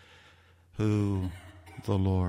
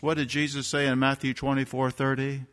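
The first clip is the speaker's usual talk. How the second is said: ordinary talk, nothing marked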